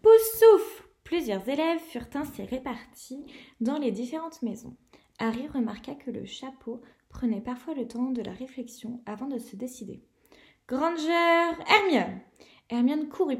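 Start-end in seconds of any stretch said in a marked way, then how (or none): 0.0-0.9 high, loud cry of a house name; booming sorting hat proclamation; shouted announcement. Acted. Names called at the sorting. 10.7-12.2 a touch louder name called out; teacher summoning a pupil by name; calling a name. Acted. McGonagall calls the next pupil.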